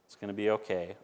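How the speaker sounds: a quiet promise, eased down; soft reassurance. Retold or imitated. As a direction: let it fall gently to comfort. Reading garbage man's tweet.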